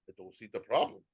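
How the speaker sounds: put-on a lightly done Luka accent, calm and a bit low. Imitating Luka brushing off the beer grab, a light Slovenian accent. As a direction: shrug it off in a hint of Luka's Slovenian accent. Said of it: "That was my Luka accent right there".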